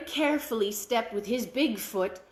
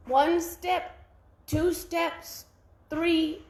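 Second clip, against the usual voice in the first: high, growing louder count to ten; counting his steps, getting louder; 4 semitones higher, 3 dB louder, much slower than usual. A narrator's reading. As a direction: build each number up.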